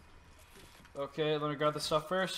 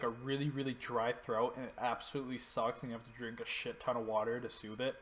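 ordinary talk, nothing marked

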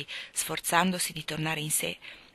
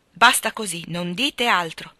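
loud, emphatic order to stop, slowing at the end; forceful cut-off; 8 dB louder than usual. A narrator's reading. Lord silencing his insistent friend, forceful cut-off. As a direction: shut the argument down firmly.

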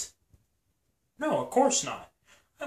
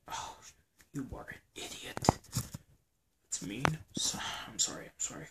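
whisper; 2 semitones lower, 9 dB quieter than usual. Acted. Whispered squabble while hiding.

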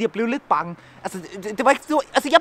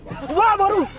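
loud high call; 11 semitones higher, 9 dB louder, slower than usual. Real, not acted. Shouting in dark at Refshaleø.

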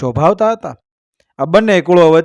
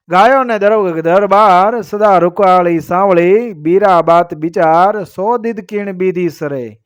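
measured, metrical couplet delivery; verse recitation; 3 semitones higher, slower than usual. A narrator's reading. Storyteller reciting a couplet to listeners, verse recitation. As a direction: recite it as an old heroic verse.

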